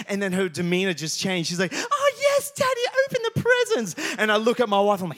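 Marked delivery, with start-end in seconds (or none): 1.7-4.1 put-on much higher, louder squeaky little-girl voice; Lydia, delighted. Daughter cheers up and urges dad to open gifts, high delighted child voice. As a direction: squeal it like a thrilled small daughter. Daughter's mood lifts again.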